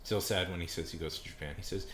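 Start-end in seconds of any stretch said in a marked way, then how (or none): none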